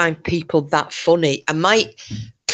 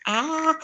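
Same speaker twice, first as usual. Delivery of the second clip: frustrated yelp; 13 semitones higher, 3 dB quieter than usual. Real, not acted. I can't get the lid off.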